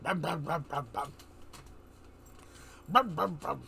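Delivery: imitating a dumb dog with mumbled brum sounds. Telling viewers how a dumb dog sounds, mock mumble. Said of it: "your standard dumb dog".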